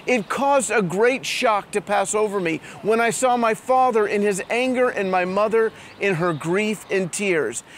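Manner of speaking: normal talking voice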